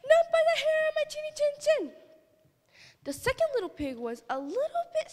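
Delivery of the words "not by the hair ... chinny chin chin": very high, loud piggy squeal. Acted. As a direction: squeal in a panic like a piglet.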